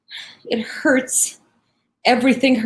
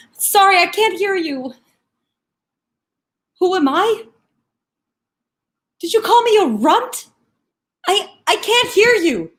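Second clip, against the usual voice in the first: strained disbelief; 5 semitones higher than usual. Acted. Detainee straining to hear, affronted.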